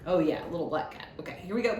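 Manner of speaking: ordinary talk, nothing marked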